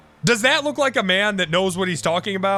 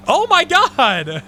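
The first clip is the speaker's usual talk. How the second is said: slow, louder, higher disbelieving cry; drawn-out exclamation; 7 semitones higher, 5 dB louder, much slower than usual. Real, not acted. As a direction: stretch it out in exasperated disbelief. Reacting to montage of himself.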